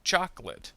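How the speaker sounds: "Chocolate." said as CHOK-lut; dropped middle syllable. Shown as a demonstration. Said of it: "middle syllable will disappear".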